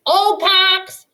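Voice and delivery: loud high bellowing call. A narrator's reading. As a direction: bellow the name across the woods. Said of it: "he bellowed".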